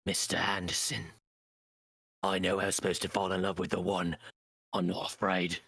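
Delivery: a hint of a solemn Trinity. Friends doing Matrix lines, lightly voiced Trinity. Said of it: "That's Trinity".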